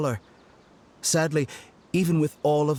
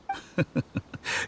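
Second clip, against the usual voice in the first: a soft, airy laugh under the breath; breathy mocking chuckle; 6 semitones higher, 5 dB quieter than usual. Scholar laughs softly at the young doctor's stubbornness, breathy mocking chuckle.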